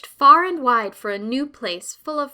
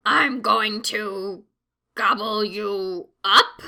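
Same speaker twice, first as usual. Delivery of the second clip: slow, hesitant troll threat; unsure troll; slower than usual. A narrator's reading. Troll faltering before the biggest goat, slow and unsure. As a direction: trail off, unsure of yourself.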